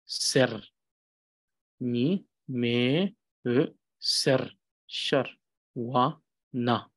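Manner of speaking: Ni, me, e, ser split apart; syllable reading. Shown as a demonstration. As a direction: clear and spaced. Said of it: "you can pronounce it like this".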